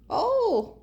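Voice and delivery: sudden high, loud oh; a startled little cry; surprised oh. Real, not acted. Owner caught off guard by a cat, a high startled oh.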